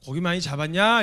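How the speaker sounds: loud call across the water to the disciples; Jesus calling loudly to the fishermen; calling out from shore. Acted. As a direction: call out to them in the boat. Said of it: "이렇게 물어보셨어요".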